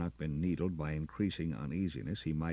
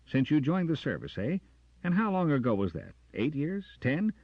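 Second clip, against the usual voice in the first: much higher, louder scornful echo; mocking echo; 12 semitones higher, 8 dB louder than usual. Jackson mocking Carter's words, high and loud. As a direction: throw his phrase back in scorn.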